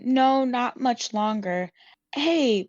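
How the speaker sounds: ordinary delivery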